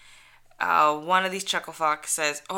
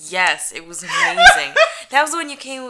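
much louder, laughing rave; excited laughing; 7 semitones higher, 14 dB louder than usual. Real, not acted. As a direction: gush about the film. Excited memory of Ghostbusters.

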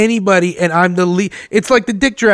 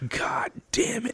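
whisper; 4 semitones higher, 15 dB quieter, slower than usual. Retold or imitated. Realizing clothes are whack.

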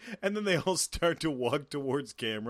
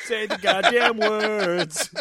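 laughing mock plea; 6 semitones higher, 8 dB louder, much slower than usual.

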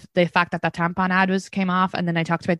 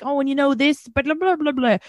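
higher, breezy imitation trailing into blah blah; mock chatty Irish American; 8 semitones higher than usual. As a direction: parody someone rattling on about Irish lore. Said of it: "they'll be like".

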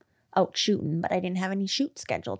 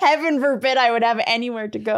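high, laughing protest; 5 semitones higher, 5 dB louder than usual. Real, not acted. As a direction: laugh through the comeback. Laughing at partner's teasing.

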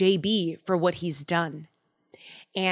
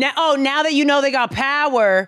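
loud, raised call-out of fakeness; mock indignation; 5 semitones higher, 5 dB louder than usual. Calling out people who fawn on power, mock indignant. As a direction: call out the two-faced, louder and higher. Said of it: "When they start changing up".

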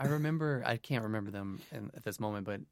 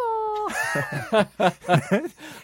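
very high choking squawk; strangled chicken squawk; 8 dB louder than usual. Pretend. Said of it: "That's a chicken's last breath".

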